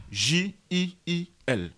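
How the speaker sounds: slow letter-by-letter spelling. Shown as a demonstration. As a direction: spell each letter slowly. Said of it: "Alors, Jill".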